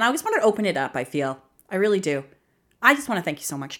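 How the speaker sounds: normal talking voice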